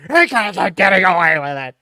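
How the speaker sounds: loud strained outcry, falling in pitch; Jesse Pinkman. Acted. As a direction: repeat the anguished protest, voice sinking. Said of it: "the Jesse Pinkman from Breaking Bad".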